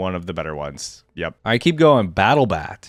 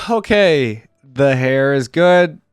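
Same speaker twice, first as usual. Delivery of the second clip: ordinary talk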